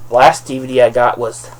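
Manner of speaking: usual speaking voice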